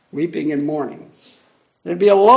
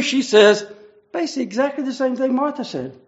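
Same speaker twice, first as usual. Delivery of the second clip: ordinary talk, nothing marked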